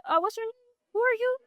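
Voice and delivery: high, innocent playing-dumb voice. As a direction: feign not knowing him at all. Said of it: "I play stupid. I play dumb".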